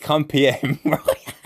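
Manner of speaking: slow words breaking into laughter; laughing. Real, not acted. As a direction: laugh as you repeat it. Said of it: "I'm wetting myself laughing".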